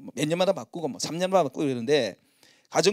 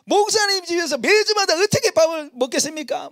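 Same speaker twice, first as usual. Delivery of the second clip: higher, louder mock protest of a flock member; 11 semitones higher, 5 dB louder than usual. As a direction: play the member who refuses, loudly. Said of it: "맨날 그러는 거예요". (밥 얻어먹기 미안해 거절하는 목장 식구, 높고 큰 목소리로 항의하듯)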